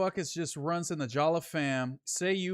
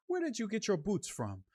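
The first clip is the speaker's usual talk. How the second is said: higher, softer botched Aussie impression; failed Australian accent; 4 semitones higher, 5 dB quieter than usual. Australian family asking about his boots, badly faked Australian accent. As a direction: attempt an Australian accent and miss. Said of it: "that's not an Australian accent".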